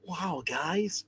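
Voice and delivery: airy drawn-out wow; lightly voiced surfer drawl; Owen Wilson impression. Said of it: "Owen Wilson".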